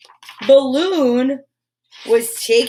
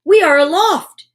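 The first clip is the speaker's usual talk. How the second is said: louder, higher exclamation of wonder; excited exclaim; 6 semitones higher, 6 dB louder than usual. Acted. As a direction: announce the liftoff thrilled. Character exclaiming in story.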